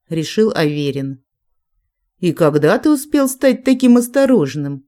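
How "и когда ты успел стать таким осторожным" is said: higher-pitched, mildly surprised question; a touch of surprise, pitched up. A narrator's reading. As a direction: ask it with raised pitch, in understated surprise. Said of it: "удивилась ведьма".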